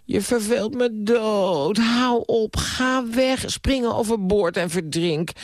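high, drawn-out exasperated wail; tormented exasperation. A narrator's reading. Niece sick of her uncle, high and tormented. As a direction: wail each dismissal in torment. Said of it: "als een gekwelde, gedoemde ziel".

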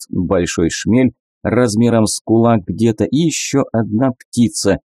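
ordinary delivery, nothing marked

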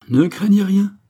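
crié très haut et fort; crié de loin. A narrator's reading. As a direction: crier à travers ses mains en porte-voix. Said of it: "cria-t-il en se faisant porte-voix". (appel crié au loin depuis le bateau)

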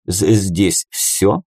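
slow stutter on the з of "здесь"; halting, drawn-out start to "здесь"; stammering. A narrator's reading. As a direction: catch on "З-" before "здесь". Brother Yur stammers a question.

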